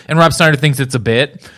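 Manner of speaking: normal talking voice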